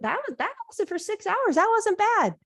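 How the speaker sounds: high, breezy and unbothered; dismissive. Retold or imitated. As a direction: wave it off with a laugh in the voice. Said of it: "And you're like".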